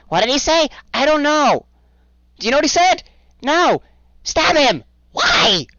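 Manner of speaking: very high, loud, frantic goblin voices; panicked creature squabble. Acted. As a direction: argue in a panic like silly little creatures. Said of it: "you hear muttering in Sylvan again".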